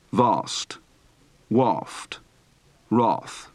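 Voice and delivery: long ah in "Vast" and "waft"; "Vast" as VAHST; open-throated vowel in "wrath"; broad open-throated A. Shown as a demonstration. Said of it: "Practice for the broad, open-throated A".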